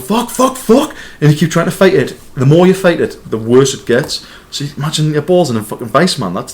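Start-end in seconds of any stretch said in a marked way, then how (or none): 0.0-0.9 panicked swearing. Retold or imitated. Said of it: "you go".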